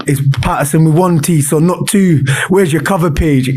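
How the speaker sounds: lightly voiced fussy Scottish teacher; strict teacher. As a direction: nitpick like a pedantic teacher. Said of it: "he always had these demands".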